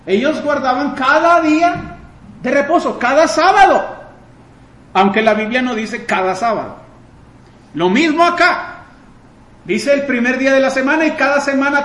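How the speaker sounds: preaching at full force. Acted. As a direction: build the sermon to a ringing climax.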